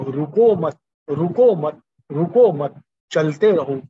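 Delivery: loud, rhythmic rallying call; rousing exhortation. Real, not acted. Preacher urging listeners not to stop, loud and rousing. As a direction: drive each repeat home with force.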